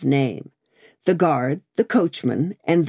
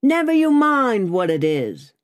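high, emphatic called retort; 8 semitones higher than usual. A narrator's reading. Guard answers the stranger in the mist, high called retort. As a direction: throw the answer back across a distance. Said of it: "the guard retorted".

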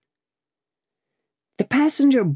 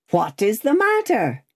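high raised query; called question; 7 semitones higher than usual. A narrator's reading. Passenger asks the messenger his business, high called question. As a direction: ask it across a distance. Passenger calls to rider in mist.

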